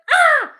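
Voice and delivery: loud, high cat-like yell; short bleating cry. Acted. Imitating the tattooed cat for viewers, yelling. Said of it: "she's like".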